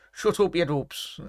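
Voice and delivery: put-on Kev's accent. Streamer imitating his friend for chat, slow mock local accent. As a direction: mimic your mate's accent, teasingly slow. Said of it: "Said that in Kev's accent".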